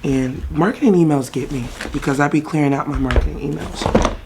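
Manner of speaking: normal talking voice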